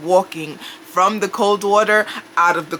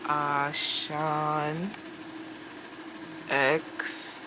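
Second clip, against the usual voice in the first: much softer, slow, dragged-out drowsy reply; delayed dazed answer; 3 semitones lower, 12 dB quieter, much slower than usual. Shown as a demonstration. Stoned patient giving her name in the ambulance, dazed and delayed. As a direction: let each word lag as if from far away. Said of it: "that's how I feel like I'm answering them".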